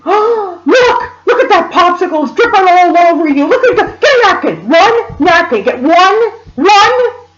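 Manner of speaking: high, loud frantic mother impression; mock hysterical scolding, held slightly back; imitated screaming. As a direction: screech urgent orders as the panicked mother. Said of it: "she started screaming".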